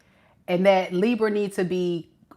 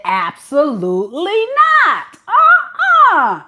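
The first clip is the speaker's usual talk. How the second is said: loud, much higher outraged yelling; Libra's outraged refusal; 10 semitones higher, 7 dB louder than usual. Retold or imitated. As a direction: shout them out of your face. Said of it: "and Libra said".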